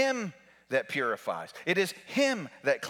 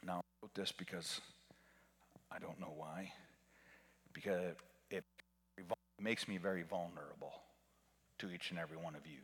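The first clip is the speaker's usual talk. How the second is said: much softer, rushing, near whisper; vulnerable hush; 9 semitones lower, 12 dB quieter, faster than usual. Real, not acted. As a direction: confess it quietly to the congregation. Vulnerable confession.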